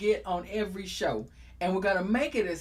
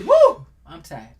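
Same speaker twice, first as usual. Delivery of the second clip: a slow, much higher and much louder woo, like mom home from work; mom's tired woo; 10 semitones higher, 14 dB louder, slower than usual. Retold or imitated. Son imitating his mother coming home: loud, drawn-out woo. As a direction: belt it out the way his mother did. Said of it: "my mom used to come off and say".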